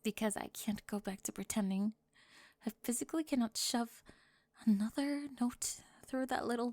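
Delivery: hushed, intimate cheerleader confessing to a classmate; soft roleplay confession. Acted. Soft-spoken roleplay confession scene.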